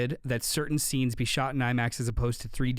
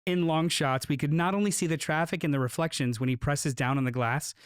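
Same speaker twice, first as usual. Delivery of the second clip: usual speaking voice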